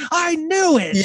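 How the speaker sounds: high-pitched retold "gotcha" cry; imitated triumphant squeal; mock triumphant. Retelling her triumphant reaction at ending.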